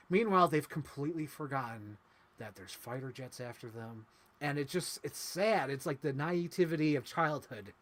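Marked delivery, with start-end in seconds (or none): none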